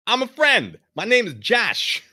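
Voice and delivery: loud, high, slow Brooklyn tough guy; a Brooklyn reporter calming a stranger; Brooklyn accent. Acted. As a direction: introduce yourself like a New York wiseguy. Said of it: "Josh's voice is gonna be, Brooklyn".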